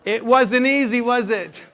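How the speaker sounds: loud teasing. Real, not acted. Coach teasing audience after the tongue twister, loud. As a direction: tease the audience after the drill.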